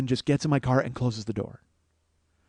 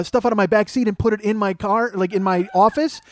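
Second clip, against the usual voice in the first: ordinary talk, nothing marked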